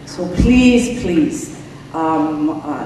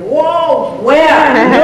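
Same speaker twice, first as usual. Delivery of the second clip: shocked outcry; 7 semitones higher, 7 dB louder, much slower than usual. Retold or imitated. Audience reacts to her claim.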